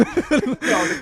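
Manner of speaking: laughing protest; loud laughing no. Real, not acted. Laughing loud no at friend's silly question. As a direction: burst out a high, loud no through laughter. Laughing outburst denying fat kid.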